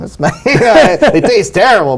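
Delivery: high, slow words broken by a laugh; slowed, high-pitched chuckle. Real, not acted. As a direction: laugh through it, amused at the memory. Laughing over the bad-tasting potions.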